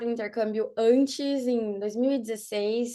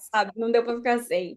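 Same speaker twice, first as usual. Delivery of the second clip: ordinary talk, nothing marked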